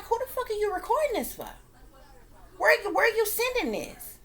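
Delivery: high-pitched, indignant demand; pissed off. Retold or imitated. Dreamer confronting the adult who is recording, pissed off. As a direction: confront them, voice pitched right up. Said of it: "I begin to get pissed off".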